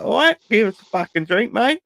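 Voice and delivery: high-pitched mock Aussie voice; Australian accent. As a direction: put on a cartoon Australian at the bar. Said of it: "I was just expecting Australian people".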